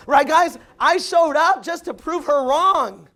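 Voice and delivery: proudly showing up to prove her wrong, loud; rallying shout. Real, not acted. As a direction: hammer it at the crowd. Rallying the audience.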